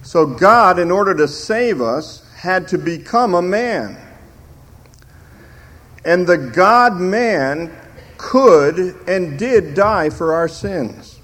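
slow, swelling preacher's build; preaching. Acted. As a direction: drive it home from the pulpit. Preaching at full force.

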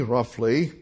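ordinary delivery

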